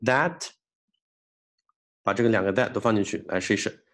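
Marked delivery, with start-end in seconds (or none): 0.1-0.4 "That" cut off on an unreleased T, pitched higher; dropped T. Shown as a demonstration. Teacher drilling students, "That" with swallowed final T. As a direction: stop on the T of "That" without releasing it. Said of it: "这个that也是".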